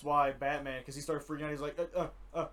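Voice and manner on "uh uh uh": higher and louder, stammering sorry; mock frantic apology; panicked apology. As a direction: stammer it out in shock. Damian panicking after accident.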